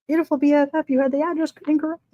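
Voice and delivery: a playful imitation of a friend gushing. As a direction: gush it like a doting friend. Rereading friend's message in her voice.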